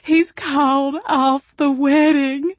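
slow, high, loud wailing sob. A narrator's reading. Jilted werewolf bride wailing to her friend, slow high sob. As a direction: wail the news out through tears. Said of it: "before she wailed".

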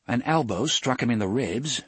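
plain reading voice

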